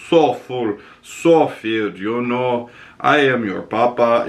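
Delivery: put-on a Russian accent, rounded vowels; lightly voiced Russian dad. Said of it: "But in his Russian accent".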